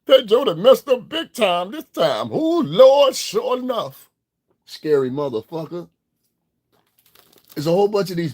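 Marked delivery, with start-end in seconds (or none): 0.1-2.3 higher, slow mocking whine; mocking whiny voice. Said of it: "That's how bitches talk". 2.3-4.1 high, loud mock wail; mock exclamation. Mocking [name] with a high wailing exclamation. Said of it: "That's how bitches talk".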